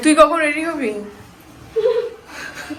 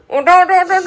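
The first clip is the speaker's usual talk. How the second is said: calling loudly to wake them; 4 semitones higher, 6 dB louder than usual. Real, not acted. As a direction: rouse them with a loud call. Waking sleepers, calling out.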